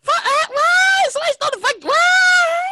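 high, slow, shouted disbelief; real disbelief at the news; shocked outcry. Real, not acted. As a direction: cry out in shock. Shocked at stolen cat.

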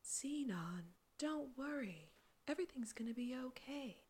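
soft breathy soothing whisper; calm them like a meditation guide; guided meditation. Retold or imitated. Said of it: "it's like a meditation voice almost".

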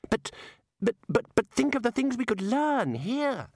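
high, stammering objection; flustered protest. Acted. Young wizard begging his colleagues to stay, flustered protest. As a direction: stumble in high-pitched, pleading to be heard. Ponder protests at hasty departure.

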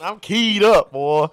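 loud, high hyped-up holler; fired up. Real, not acted. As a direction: pump it up, laughing at the end. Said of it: "I'm fired up about it".

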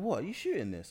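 mildly surprised question. Retold or imitated. Retold surprised question from acquaintances.

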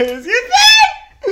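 high, slow laughing yell; laughing shout. Real, not acted. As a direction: squeal it out laughing. Impatient drunk friend.

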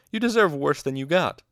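loud, high, scathing rebuke; angry outburst. A narrator's reading. Master tells off his colleague, angry outburst. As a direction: tear into a colleague. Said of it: "Elxadal burst out".

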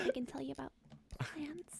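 breathy mock-secret whisper, rising at the end; playful whisper. Offering a friend plant facts, playful whisper.

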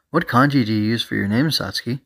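plain reading voice

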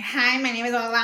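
slightly high mocking echo of a greeting; angry mimicry. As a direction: lightly parrot the introduction back in scorn. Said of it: "I went off on that lady".

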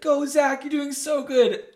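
high, loud mock cheer from the sidelines; imitated cheering. Family stopping to support the skater, high loud imitated cheer.

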